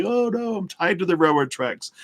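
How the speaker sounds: high, hammed-up distressed damsel. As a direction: squeal it like a helpless damsel. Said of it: "We love feeling like the damsel in distress".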